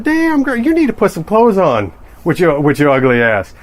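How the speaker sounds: high, brash, mocking catcall; rude catcaller. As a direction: jeer it like a crude guy at the store.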